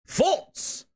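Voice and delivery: high mock squeak of False; mocking quote. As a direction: sneer the word False up high. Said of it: "Bootleg Jez said".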